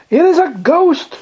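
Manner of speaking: high-pitched cry of alarm; acted fearful exclamation; cried out in fear. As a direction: cry out as a frightened disciple. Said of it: "they cried out for fear".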